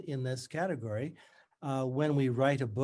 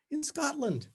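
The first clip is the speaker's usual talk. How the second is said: strangulated faux brightness; 13 semitones higher than usual. A narrator's reading. Tutor greets her old student, strangulated and falsely bright. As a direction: push it through gritted teeth with forced cheer. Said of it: "In her strangulated voice".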